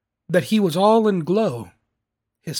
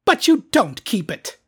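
raised, loud objection; protesting nephew; 5 semitones higher, 5 dB louder than usual. A narrator's reading. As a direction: object in a lifted voice.

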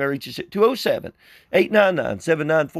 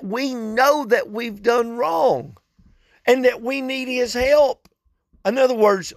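higher-pitched pulpit exhortation; sustained high preaching tone, stretching key phrases; earnest preaching; 4 semitones higher than usual. Acted. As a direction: preach it in a raised, insistent voice.

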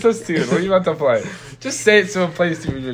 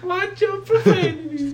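high-pitched imitation of a video clip; sound clip imitation. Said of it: "I thought that it would just be".

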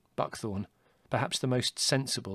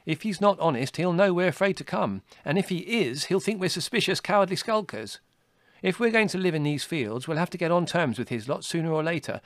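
higher and louder, eager storyteller; Dandelion voice; 6 semitones higher, 5 dB louder than usual. A narrator's reading. Dandelion to Hazel about visiting Cowslip, higher, eager and louder. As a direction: push the point with quick spirit.

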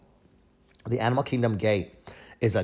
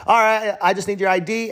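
lightly voiced guard, pitched up; imitated security guard; 9 semitones higher, 3 dB louder than usual. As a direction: mimic a guard's routine request in a higher, louder voice. Acting out security guard's routine request.

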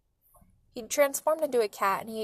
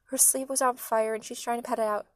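usual speaking voice

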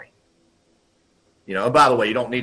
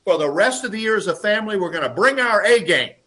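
higher, louder solemn declaration; mock announcement; 7 semitones higher, 5 dB louder than usual. Father announcing the family motto, raised voice. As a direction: declare it like a boss to staff. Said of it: "He goes".

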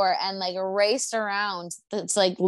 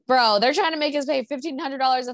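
higher-pitched mock outrage over the rent; retold indignation; 4 semitones higher, 3 dB louder than usual. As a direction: act out a neighbor griping, pitched up. Acting out neighbors' rent complaint.

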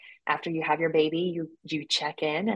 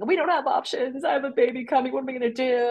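retold bawling; 7 semitones higher than usual. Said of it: "I remember just bawling and saying".

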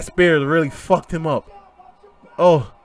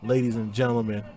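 lower, softer, choked-up tribute; getting emotional; 5 semitones lower, 9 dB quieter than usual. Real, not acted. Host paying tribute to Eddie after the match, quiet and choked up. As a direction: let the words sink, moved almost to tears. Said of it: "as I'm getting all emotional".

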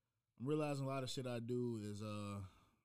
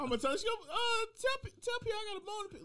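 high loud indignant rant; loud complaining; 8 dB louder than usual. Real, not acted. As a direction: yell it indignantly.

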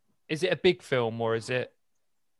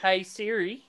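put-on higher Aussie-accented call to Siri; 6 semitones higher than usual. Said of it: "your Aussie accent's bullshit".

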